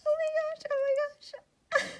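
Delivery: very high, squeaky stifled squeal; stifled scream. Real, not acted. Fangirling while someone sleeps in the house, stifled squeal. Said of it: "I can't, I can't scream".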